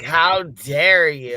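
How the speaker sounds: loud, slow, hammed-up indignation; mock Greta outrage. Co-host piling on the Greta bit, loud slow mock outrage. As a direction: drag it out and bellow it in mock fury. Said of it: "It's like".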